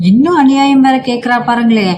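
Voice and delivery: scheming mother-in-law. Acted. Mother-in-law complains to father-in-law.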